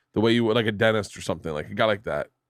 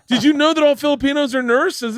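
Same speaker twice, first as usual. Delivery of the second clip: excited mock nurse; 14 semitones higher, 5 dB louder than usual. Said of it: "And he goes".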